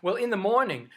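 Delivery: ordinary delivery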